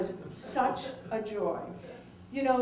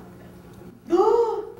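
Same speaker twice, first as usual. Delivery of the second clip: high delighted gasp; 13 semitones higher, 7 dB louder than usual. Retold or imitated. Guest delighted by film.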